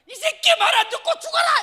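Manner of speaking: very high, very loud shriek; hammed-up enraged mother yelling; mother's furious scream. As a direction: scream it while beating the son. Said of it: "이러면서 엄마가 더 열나게 패는 거야".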